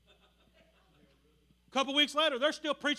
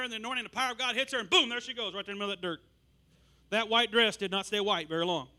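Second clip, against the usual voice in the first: normal talking voice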